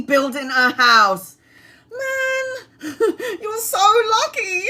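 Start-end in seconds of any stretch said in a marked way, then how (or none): 1.9-4.6 very high, laughing, half-crying gush; excited squeal. Real, not acted. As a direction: squeal with envious joy. Reacting to viewer building house.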